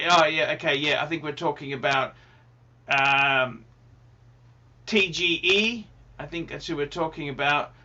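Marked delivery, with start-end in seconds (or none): none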